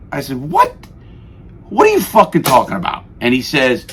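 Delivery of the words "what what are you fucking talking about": sharp high what, then fast disbelief; shocked indignation. Retold or imitated. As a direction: play stunned and outraged at the news. Retold indignant reply to lieutenant.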